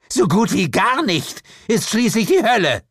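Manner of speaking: high, loud, emphatic grumble; indignant complaint. A narrator's reading. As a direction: complain emphatically with rising pitch. Lilith indignant about hell's supply. (empört klagen)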